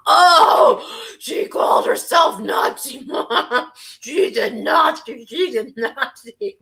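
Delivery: hammed-up shocked accuser; mock outrage. Mocking critics' outrage.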